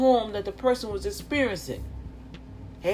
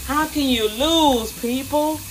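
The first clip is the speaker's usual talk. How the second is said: rising preaching; 3 semitones higher than usual. Real, not acted. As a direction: climb until you're preaching. Builds into preaching.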